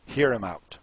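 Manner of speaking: ordinary talk, nothing marked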